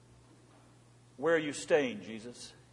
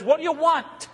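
higher, pointed question in Christ's voice; Christ challenging; 9 semitones higher, 4 dB louder than usual. Acted. Jesus confronting Andrew and John, high pointed challenge. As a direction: put the question straight to them as Jesus would. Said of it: "He says".

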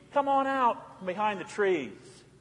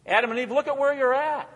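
usual speaking voice

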